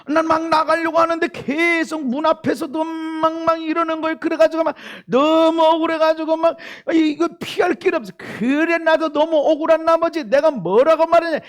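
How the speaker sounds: high, loud, worked-up storytelling; heated retelling. Retold or imitated. Husband recounting the quarrel at the door, worked up. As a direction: build up to the outburst.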